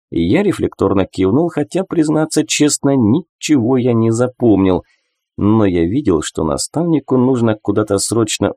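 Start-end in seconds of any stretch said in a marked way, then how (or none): none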